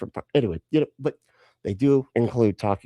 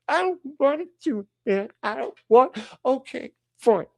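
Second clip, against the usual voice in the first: very high-pitched petulant refusal; hammed-up childish sulk; whiny child; 14 semitones higher than usual. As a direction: whine it like a stubborn kid. Peter retold as sulky child.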